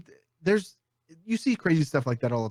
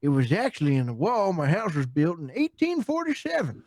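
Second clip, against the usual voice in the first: put-on old Tennessee man; 5 semitones higher than usual. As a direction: play an elderly Tennessee man showing off his find. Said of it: "ninety-seven-year-old man from Tennessee".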